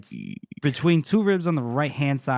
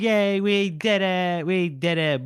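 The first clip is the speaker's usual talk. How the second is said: high, drawn-out victory cheer; playful mock celebration; happy cheering; 5 semitones higher, slower than usual. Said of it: "the Carls are all happy".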